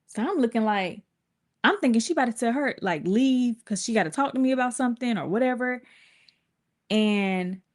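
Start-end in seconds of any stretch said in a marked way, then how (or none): none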